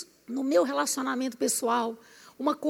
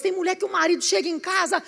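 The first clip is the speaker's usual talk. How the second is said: ordinary talk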